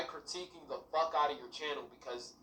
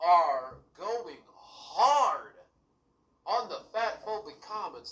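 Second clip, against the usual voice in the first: slow loud scold; 3 semitones higher, 8 dB louder, slower than usual. Real, not acted. Scolding the YouTuber.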